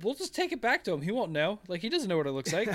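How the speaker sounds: high, giggly sheepish excuse; sheepish cover-up. Retold or imitated. Player excusing the smashed artifact to the party, high and giggly. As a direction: talk your way out of it, half laughing. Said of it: "And I was like".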